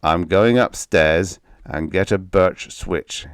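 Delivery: ordinary delivery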